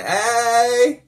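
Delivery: high, loud drawn-out hail; imitated call-out. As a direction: call across a parking lot as the girl. Girl calls across parking lot.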